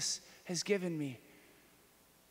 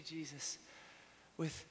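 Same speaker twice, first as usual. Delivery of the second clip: ordinary talk, nothing marked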